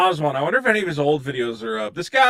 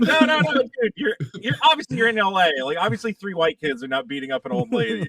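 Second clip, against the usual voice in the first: higher protesting voice; 6 semitones higher than usual. Retold or imitated. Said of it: "they're like".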